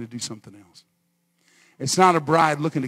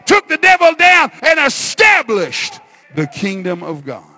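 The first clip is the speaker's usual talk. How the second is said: loud sermon climbing to a high shout; triumphant preacher at full force; fiery preaching; 8 semitones higher, 12 dB louder than usual. Real, not acted. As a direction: drive it harder phrase by phrase, slowing at the end.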